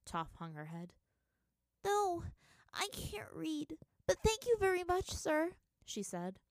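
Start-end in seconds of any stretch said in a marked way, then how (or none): 1.8-5.6 put-on high, meek little-girl voice; girl meekly thanking the merchants; sweet helpless girl. A narrator's reading. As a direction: play small and grateful. Toph playing helpless girl.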